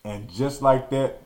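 ordinary delivery

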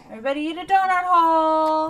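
loud, high call-out; cheery announcement. Pretend. Celebrating a computer notification with a loud cheer.

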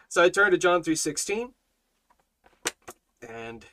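ordinary talk

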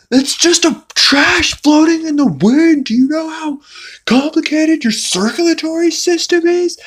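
very high, loud rant; hammed-up incredulous scolding; mock exasperated yell. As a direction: shout it down in comic outrage. God yells back from heaven.

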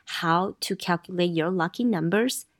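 ordinary talk, nothing marked

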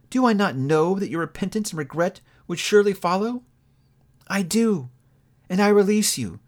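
a hint of a hurt young woman letting go; Belle, lightly voiced. A narrator's reading. Young woman to her changed lover, lightly voiced and sorrowful.

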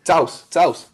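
"Taus", a T stuck straight onto "house"; fuse article and noun into one syllable, "Taus"; northern contraction. Shown as a demonstration. Showing how northerners say the house, demonstrating "Taus". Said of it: "it'll just be like".

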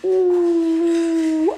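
very high, hooting owl, sly and sweet; a sugary high owl inviting the mouse to tea. Acted. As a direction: coo like a crafty old owl luring its dinner. Owl startled.

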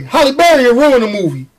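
usual speaking voice

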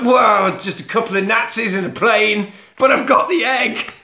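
high, hammed-up crook's brag; acted excited boast. Diver boasting to partner about the egg, acted excitement. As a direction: brush it off, then crow about the prize.

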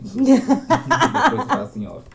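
high, laughing promise. Real, not acted. Guest laughing as he promises the host an impression later.

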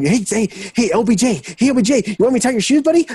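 high, fast, eager fanboy pleading; giddy sidekick begging to please; Trubisky fanboying. Retold or imitated. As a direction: fawn over him like a clingy kid. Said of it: "Mitch Trubisky would be just like".

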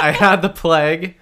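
loud laughing repeat. Real, not acted. Laughing echo of remark.

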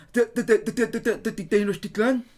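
high, loud stammering schoolkid; stuttering nervous reader. Retold or imitated. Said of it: "que le hace".

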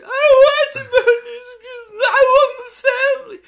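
very loud, high fake wailing; mock bawling kid. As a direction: bawl it out like a spoiled child. Mocks a whining, crying child.

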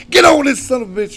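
loud, high shouted command; angry order. Retold or imitated. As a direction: re-enact a dad barking the kid into place. Father shouts back at son.

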